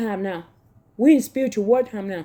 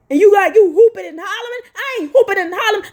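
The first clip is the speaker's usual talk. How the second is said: rapid-fire, higher, pushing back hard; fast heated retort; 7 semitones higher, faster than usual. Real, not acted. Preacher snapping back at critics, fast and higher.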